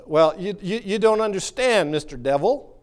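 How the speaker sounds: usual speaking voice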